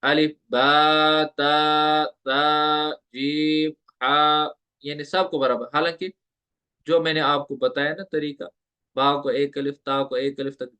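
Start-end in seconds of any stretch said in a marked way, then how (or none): 0.5-4.5 बा ता ता जीम हा all held for the same short length; every letter given equal length, the wrong way; common mistake. Shown as a demonstration. Teacher imitating students' mistake, all letters evenly timed. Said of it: "जैसे सबको पढ़ेंगे, ऐसे पढ़ेंगे".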